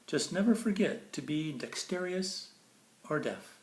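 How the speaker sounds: bright, lilting storybook read; sing-song picture book reading; reading to children. Acted. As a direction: read it like bedtime verse for a child. Reads Dr. Seuss to kids.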